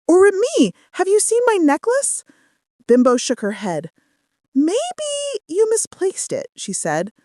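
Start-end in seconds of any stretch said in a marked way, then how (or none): 0.1-2.2 higher, anxious friend's voice; worried question. A narrator's reading. Anita back at Bimbo's asking, high anxious voice. Anita asks about lost necklace. 4.5-6.5 high, slow, falsely sweet voice; forcing a smile. A narrator's reading. Bimbo lying to Anita, high forced-smile voice. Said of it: "forcing a smile".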